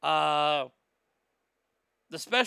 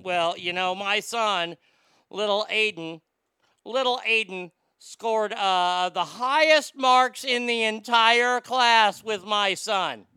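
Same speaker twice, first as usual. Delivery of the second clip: bragging parent; 3 semitones higher, 6 dB louder, slower than usual. Retold or imitated. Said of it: "talking loud about their honor roll student".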